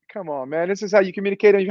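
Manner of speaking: normal talking voice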